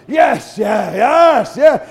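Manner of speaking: loud, excited agreeing; can't wait to hunt; eager loud yeses. Retold or imitated. Barry eagerly agreeing about hunting morning, loud.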